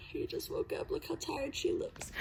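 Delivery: quiet teasing whisper. Real, not acted. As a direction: whisper so she stays asleep. Filming sleepy friend quietly.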